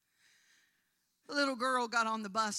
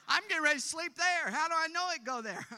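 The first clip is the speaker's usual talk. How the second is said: mock panic; 8 semitones higher, 8 dB louder, faster than usual. Exasperated retelling of rat panic.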